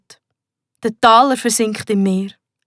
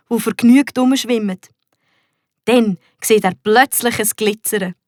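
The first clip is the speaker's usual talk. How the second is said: ordinary delivery, nothing marked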